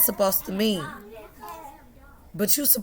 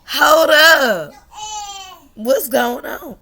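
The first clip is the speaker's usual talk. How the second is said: high, loud startled question; laughing alarm; 8 semitones higher, 9 dB louder, much slower than usual. Retold or imitated. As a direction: stop short in shocked surprise. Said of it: "I was like".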